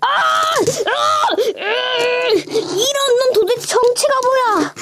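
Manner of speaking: high strained cries into a drawn-out complaint; frustrated outburst. Real, not acted. Reviewer demanding what this toy is, exasperated high wail.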